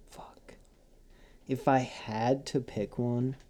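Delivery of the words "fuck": breathy whispered fuck after a sigh; sigh, then whisper it; whispered curse. Real, not acted. Quiet sigh while reading notes.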